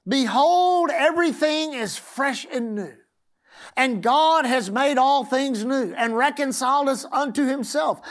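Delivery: slow, swelling scripture reading; preaching. Acted. Preacher reading scripture to the congregation, slow and swelling. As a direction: preach it over the congregation, drawn out.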